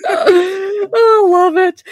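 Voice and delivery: high, loud, gushing delight; delighted squeal. Real, not acted. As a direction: squeal with joy at the chat.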